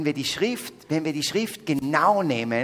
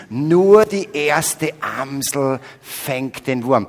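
slow, louder, proclaiming each word; proclaimed proverb; 9 dB louder, much slower than usual. Shown as a demonstration. Preacher repeats the saying louder and slowly for the audience. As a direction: announce it to the whole hall. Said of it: "Berührt euch das Wort so wie mich?".

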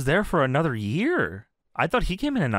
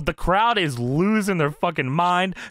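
normal talking voice

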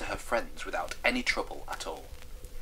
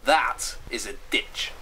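slow, deliberate verdict; measured, emphatic put-down; firm; 2 semitones higher, 4 dB louder, slower than usual. Acted. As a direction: spell out the judgement firmly. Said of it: "she answered firmly".

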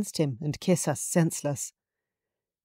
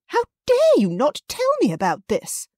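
much higher and louder outrage; indignant mother; 12 semitones higher, 5 dB louder than usual. A narrator's reading. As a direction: scold the daughter, high and loud. Justine furious at her daughter.

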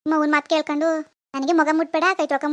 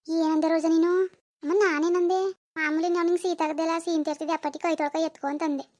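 ordinary delivery

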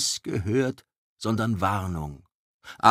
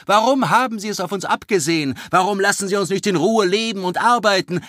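much higher, fast and upset; agitated outcry; 12 semitones higher, 9 dB louder, faster than usual. Acted. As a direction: pour out the questions in alarm. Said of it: "rief Vera Löwenhaupt". (hoch, schnell, aufgebracht)

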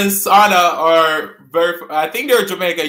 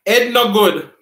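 Jamaican "Ed no good", a bit louder; Jamaican patois; 3 semitones higher, 4 dB louder than usual. Shown as a demonstration. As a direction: drop the H, patois style. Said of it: "We don't pronounce our H's in Jamaica".

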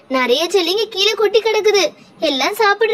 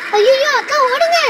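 higher-pitched cry of alarm; acted alarm, pitched up; panicked warning; 6 semitones higher than usual. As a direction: warn them to run, in a panic. Warns others of runaway horse.

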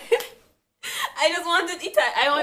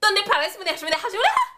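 rising, ever higher and louder fake Spanish; mock Spanish accent; 10 semitones higher, 8 dB louder, faster than usual. Friend tries Spanish for a laugh, rising mock Spanish. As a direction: push each repeat higher, mocking. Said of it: "sounds like Korean or Chinese".

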